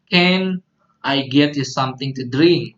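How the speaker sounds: teacher models a casual offer to a friend; teacher drill. Shown as a demonstration. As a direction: punch "Can" then let "drink" fall. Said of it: "Repeat after me".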